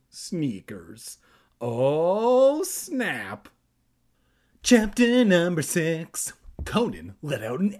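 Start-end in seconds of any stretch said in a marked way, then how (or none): 1.6-3.5 high, loud drawn-out "oh", dropping on "snap"; mock dismay. Host's mock reaction to the prank. 4.6-6.3 high, loud announcer call; sing-out title call, pitched up; hammed-up chapter announcement. Mock grand chapter announcement.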